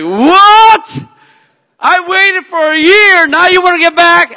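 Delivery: high, loud, indignant outburst; fuming. Retold or imitated. Answering his ex on the phone, fuming. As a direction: blast it in disbelief. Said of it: "I was fuming".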